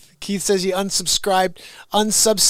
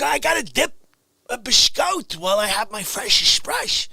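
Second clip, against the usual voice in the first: usual speaking voice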